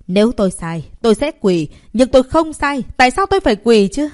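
loud, rising indignant protest. A narrator's reading. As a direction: fire it back, outraged. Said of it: "phẫn nộ nói". (phẫn nộ nói lớn)

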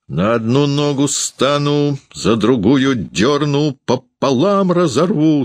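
high, drawn-out savage threat; ferocious shout. A narrator's reading. Landlady threatening Varvara, ferocious high cry. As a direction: threaten fiercely on a high, stretched pitch. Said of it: "свирепо кричала Ершова".

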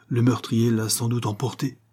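plain reading voice

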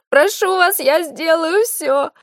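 high, slow, desperate plea; desperate begging. Acted. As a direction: beg for your life, high and slow. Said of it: "мольбу".

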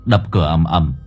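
ordinary delivery, nothing marked

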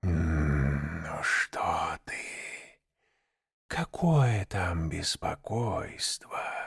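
slow, soft snake whisper; rustling serpent whisper. A narrator's reading. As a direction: brush the words like a snake. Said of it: "прошелестела змея".

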